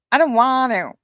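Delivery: high, loud, whining. Real, not acted. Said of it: "I sound like a whiny baby tonight".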